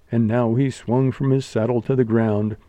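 ordinary delivery

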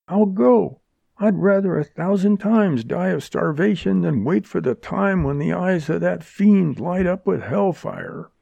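high-pitched, impassioned declaration; crying out. A narrator's reading. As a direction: keep the desperate cry going. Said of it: "she cried".

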